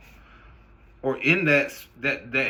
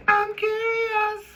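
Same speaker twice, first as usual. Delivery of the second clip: squeaky high-pitched slow "I'm curious"; drawn-out falsetto mockery of nosy people; much slower than usual. Mocking nosy people in a high squeaky voice. Repeated mock voice.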